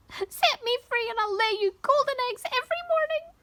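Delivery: high-pitched talking bird; clucking hen. A narrator's reading. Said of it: "she clucked".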